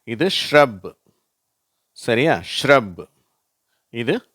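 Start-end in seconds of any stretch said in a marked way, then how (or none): 0.3-1.0 "shrub" with sh and r run together; teacher's careful "shrub", shr blended; merged shr. Shown as a demonstration. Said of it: "இதை நான் வாசிக்கிறேன்". 2.6-3.1 "shrub" repeated with sh and r blended; teacher's model "shrub"; merged shr. Shown as a demonstration. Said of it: "எல்லாத்தையும் சேர்த்து அப்படியே merge பண்ணி".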